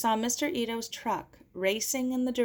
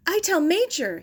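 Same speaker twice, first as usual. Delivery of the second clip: high, loud call over the shoulder; shouted; 10 semitones higher, 7 dB louder than usual. Acted. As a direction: yell it back while running. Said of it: "he shouted over his shoulder".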